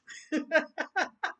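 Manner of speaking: bright rising laugh at herself; a quick giggle that climbs up; real laugh. Real, not acted. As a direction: laugh off the joke. Laughs at own joke.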